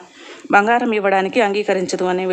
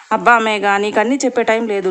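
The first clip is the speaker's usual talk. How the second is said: ordinary delivery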